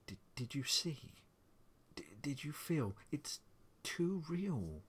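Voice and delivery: high, halting, distressed voice; tearful and frightened. A narrator's reading. Wife crying against husband about the nursery, frightened. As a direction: stammer it, rising into distress. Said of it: "cried steadily".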